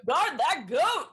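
high, slow Swedish lilt; Swedish accent. Shown as a demonstration. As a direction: sing it out Swedish style. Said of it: "do you know how to do a Swedish accent?".

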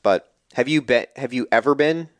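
normal talking voice

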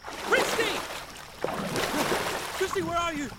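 high desperate calling out; yelling her name in panic; frantic shouting. Acted. Calling across water, lost.

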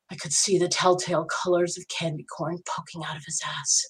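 plain reading voice

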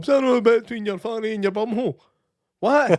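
mock quote. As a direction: toss the joke back in a lightly put-on voice. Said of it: "I liked how you nearly messed that up".

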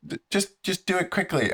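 squeaky impatient neighbor impression; high mocking voice.

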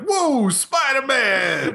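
high, stretched-out hammy cheer; imitated yelling. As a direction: mimic an overexcited gamer in an ad. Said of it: "he just keeps yelling".

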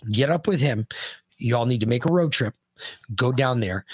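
ordinary talk, nothing marked

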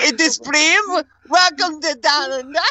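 put-on high laughing Australian accent; laughing, a jokey Aussie host. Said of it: "try to do an Australian".

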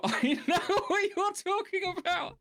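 very high, slow put-on voice dissolving into laughter; laughing repeat. Cracking up at the sinking-Keith joke, laughing through the line.

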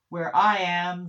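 declaimed boast. Acted. Deity boasting in declaimed text.

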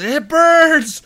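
loud, very high-pitched imitation of agony; high mock wail of burning pain; mock pained cry. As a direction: cry out as if stung, hammed-up. Imitates teammate crying out in burning pain.

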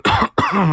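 gruff throat clear. Acted. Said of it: "He coughs again".

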